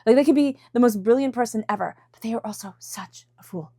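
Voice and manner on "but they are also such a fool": hushed, confiding judgment; whispered, as if letting slip a secret; whispered aside. Real, not acted. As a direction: drop into a whisper for the punchline. Whispered punchline after praise.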